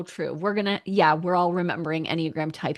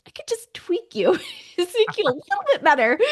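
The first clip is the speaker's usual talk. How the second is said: very high, squeaky teasing; laughing mock-sweet fixer voice; playful meddling; 14 semitones higher, 5 dB louder than usual. Wife offering to improve husband, playfully meddling.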